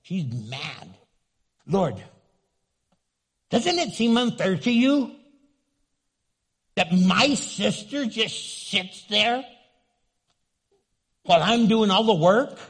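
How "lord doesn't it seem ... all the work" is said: annoyed. Retold or imitated. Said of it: "she's bugged though. She's mad".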